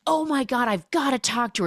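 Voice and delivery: hammed-up sudden resolve; urgent excitement. Meg learns of the proposal, high and urgent.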